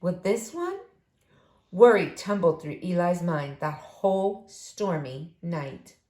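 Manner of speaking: usual speaking voice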